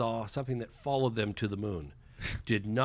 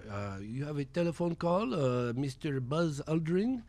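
put-on French-accented concierge, slowing on the name; 3 dB quieter, slower than usual. Concierge tells guest of a phone call, French accent. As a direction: imitate a French concierge announcing a caller. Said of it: "this concierge comes up to me".

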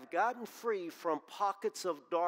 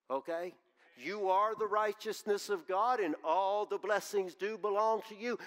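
preaching; 3 semitones higher than usual. Acted. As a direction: drive the warning home to the congregation. Preaching the main point.

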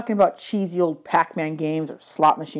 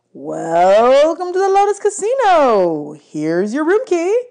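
high, loud, slow welcome; overly cheerful casino bellhop; bellhop greeting; 8 semitones higher, 6 dB louder, slower than usual. A narrator's reading. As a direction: roll out the welcome like a showman.

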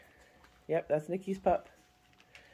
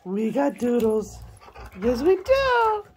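slow, high sing-song; 8 semitones higher, 7 dB louder, slower than usual. Real, not acted. Owner greeting arriving doodles, slow sing-song. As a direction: croon the dogs' name.

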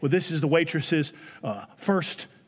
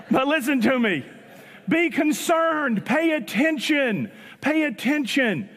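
higher, slow pleading; preaching exhortation; 8 semitones higher, slower than usual. Acted. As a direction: plead with the congregation.

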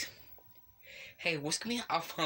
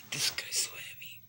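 whisper. Real, not acted. Straining aside while moving mob.

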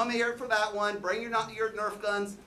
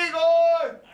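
Igor! yelled high and much louder; calling out; 8 semitones higher, 10 dB louder than usual. Acted. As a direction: bellow Igor across the hall. Host calls assistant loudly.

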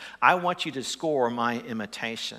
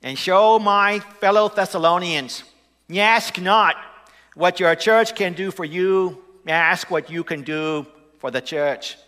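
loud, higher Boston oratory; John F. Kennedy; 7 semitones higher, 10 dB louder than usual. Pretend. Pastor doing a Boston president's impression, loud oration. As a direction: proclaim it like a Boston president's speech. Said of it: "John F. Kennedy".

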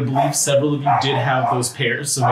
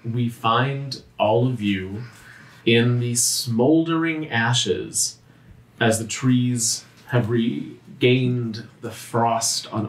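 slow, hushed narration with long pauses; slow storyteller voice, sinking softer at the ends; game master scene setting; 3 semitones lower, 3 dB quieter, slower than usual. A narrator's reading. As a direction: paint the scene gravely, phrase by phrase.

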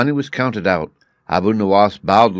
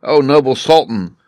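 mock weeping; 5 semitones higher, 6 dB louder than usual. A narrator's reading. Jester fakes grief before the Sultan, weeping loudly. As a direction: put on a show of grief. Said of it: "wept he".

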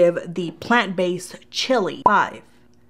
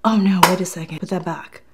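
normal talking voice

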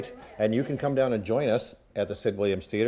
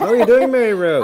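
excited; 13 semitones higher, 14 dB louder than usual. Real, not acted. Cohost greets loudly on air.

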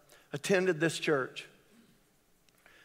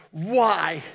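grieving cry; 2 semitones higher, 6 dB louder than usual. Retold or imitated. Grieving a friend's death.

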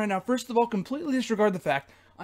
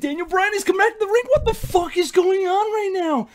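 real frantic excitement, high and loud; going nuts; 8 semitones higher, 6 dB louder than usual. Real, not acted. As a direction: blurt it out in stunned, high-pitched excitement. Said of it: "I'm going nuts right now".

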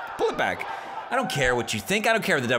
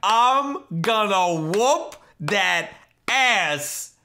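very slow, stretched-out warning; higher, slow mock menace; drawn-out threat; 4 semitones higher, much slower than usual. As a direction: draw every word out as you vow payback. Threatens the rival who left.

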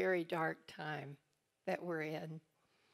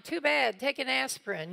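higher, louder, dismissive quip with a laugh; mock brusque wife; 6 semitones higher, 6 dB louder than usual. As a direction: brush it off, half laughing. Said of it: "I'm like".

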